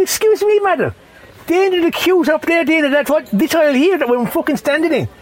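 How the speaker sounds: high-pitched, pointed correction; lightly acted high-voiced telling-off; indignant mock politeness. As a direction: raise the voice high to challenge a queue jumper. Acted confrontation with queue jumper.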